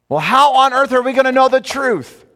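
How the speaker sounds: high and loud, calling it out to the room; preaching at full force; booming rhetorical question. Acted. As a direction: throw the question out to the congregation.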